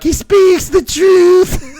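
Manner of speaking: high, loud, slow hammed-up declaration; mock proclamation. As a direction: proclaim it like a prophet. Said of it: "She's like".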